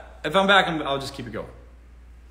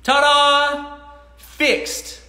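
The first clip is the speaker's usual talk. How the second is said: high, loud ta-da flourish; mock triumphant; 11 semitones higher, 8 dB louder, much slower than usual. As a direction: present the fix like a magic trick.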